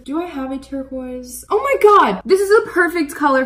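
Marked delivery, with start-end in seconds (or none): 1.5-3.4 high, loud cry of delight; thrilled outburst. Real, not acted. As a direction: exclaim it loudly, pitched right up. Finds the perfect colour.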